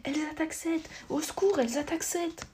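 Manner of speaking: put-on a girl screaming that the fairies attack Seth; screaming for help. A narrator's reading. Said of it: "Kendra hurla".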